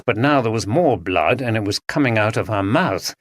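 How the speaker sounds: usual speaking voice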